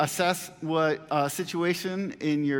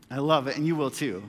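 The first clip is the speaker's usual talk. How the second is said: usual speaking voice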